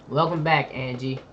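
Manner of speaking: ordinary talk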